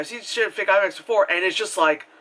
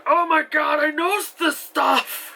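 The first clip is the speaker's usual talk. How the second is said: high, drawn-out gleeful squeal; giddy recognition; 11 semitones higher, slower than usual. Retold or imitated. Watching the film, physics fan recognizing the terms, giddy squeal. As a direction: squeal it like an overexcited kid.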